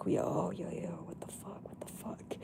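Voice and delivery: shocked whisper, fading to breath. Retold or imitated. Reacting to friend's New York plan in a shocked whisper. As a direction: hiss it out in disbelief.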